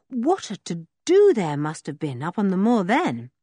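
usual speaking voice

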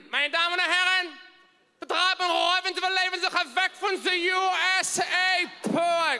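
barking fake German at the passengers; German stewardess announcement. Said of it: "She began".

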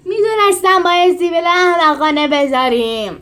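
loud griping complaint; grumbling. A narrator's reading. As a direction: complain about the moustache. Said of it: "غر زد".

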